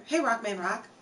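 usual speaking voice

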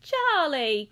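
loud, high, delighted exclamation; high, loud burst of delight; excited cry. A narrator's reading. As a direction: cry out the name in welcome. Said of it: "cried Mister Wonka".

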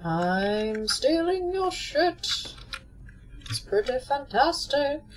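high, slow gloating taunt; gleeful sing-song. Real, not acted. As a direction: drawl it like a gleeful thief. Looting the dead gleefully.

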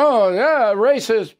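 mocking accuser.

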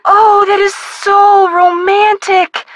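high, drawn-out teasing swoon; mock swoon. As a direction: gush over it slowly, all sarcasm. Teasing about a business romance.

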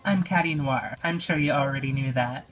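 normal talking voice

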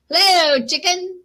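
storybook duck hailing the dog; cheery duck greeting. Acted. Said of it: "said Duck".

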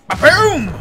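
big, high "ba-boom" blast; booming mock gunshot; shouted explosion noise. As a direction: yell it like a gun going off. Voices the gun's blast.

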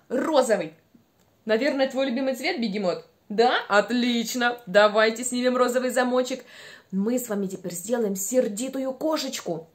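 ordinary delivery

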